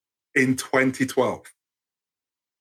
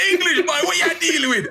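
lightly imitated Caribbean kid, laughing; a hint of a Caribbean boy's taunt; 14 semitones higher than usual. Speaker imitating a Caribbean boy calling him English. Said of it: "a ten-year-old with a deeper voice".

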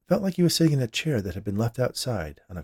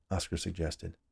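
plain reading voice

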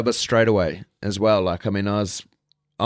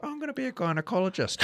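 put-on little kid voice; 11 semitones higher, 6 dB quieter than usual. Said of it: "And I'd be".

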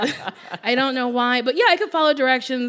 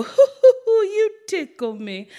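much higher, louder hooting granny laugh; Barbados granny laugh; 10 semitones higher, 4 dB louder than usual. Granny laughing at a joke, high hooting imitation. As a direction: hoot it out like a delighted Barbadian grandmother. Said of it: "she has a lot of things that she says".

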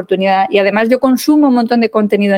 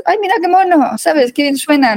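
high-pitched, charmed gushing; imitating someone utterly charmed; mock cooing admiration; 6 semitones higher than usual. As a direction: coo as if it's adorable. Mimics people charmed by French accent.

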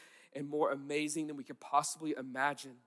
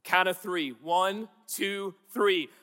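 ordinary delivery

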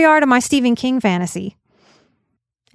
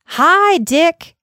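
higher, louder burst of a greeting; forceful thought; 8 semitones higher, 4 dB louder than usual. Acted. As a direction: fling the words out like a fastball. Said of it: "the thought arrowed out of him with a force".

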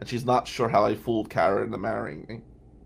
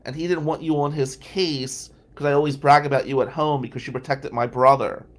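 put-on gushing girl; 3 semitones higher, 8 dB louder than usual. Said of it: "She goes".

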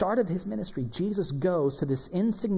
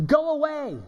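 higher, retold demon's yell; crying out; 7 semitones higher, slower than usual. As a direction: cry out like a demon confronted. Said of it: "cried out at the top of his voice".

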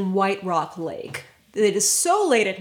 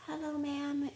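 put-on timid girl; 5 semitones higher, 13 dB quieter than usual. Lost wet girl greets them.

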